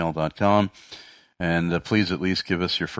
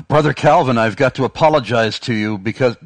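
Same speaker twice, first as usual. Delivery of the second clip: louder, higher voice calling out an apology; loud, eager confession to a brother; running-up apologizer; 6 semitones higher, 7 dB louder than usual. Acted. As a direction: act out someone rushing up to apologize. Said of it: "former Arminian running towards him and saying".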